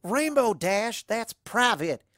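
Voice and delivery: indignant hushing of a teasing partner; embarrassed scolding hiss. A narrator's reading. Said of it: "she hisses".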